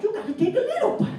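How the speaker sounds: fast, high mock of dad's catchphrase; quick high dad mimic. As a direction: toss dad's own line back at him. Son throws dad's line back.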